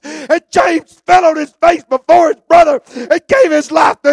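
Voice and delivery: loud, driving sermon delivery at full voice; loud, building pulpit preaching; full-force preaching. Acted. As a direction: preach it big to the whole room.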